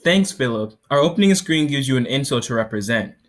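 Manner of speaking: loud, projected product demo; startup pitch. Acted. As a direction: sell it to the judges.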